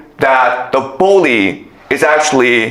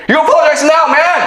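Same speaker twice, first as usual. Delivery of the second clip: bully's demand; 11 semitones higher, faster than usual. Retold or imitated. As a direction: bark the demand like a playground bully. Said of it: "comes out next day and says".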